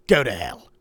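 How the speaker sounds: loud, curt snap; snapping. Acted. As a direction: bite the words off angrily. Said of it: "he snapped".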